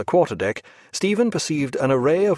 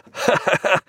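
bright gleeful laugh, high; a burst of glee he can't hold in; laughing aloud; 7 semitones higher than usual. Acted. Mate laughing aloud at the thought of prizes, gleeful. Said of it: "Mister Honey laughed aloud".

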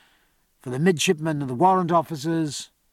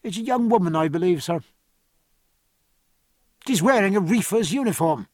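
understated, matter-of-fact report to a superior; neutral voice; 3 semitones higher than usual. A narrator's reading. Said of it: "said in a neutral voice".

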